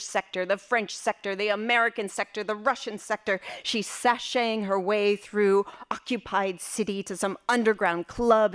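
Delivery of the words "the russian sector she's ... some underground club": ordinary delivery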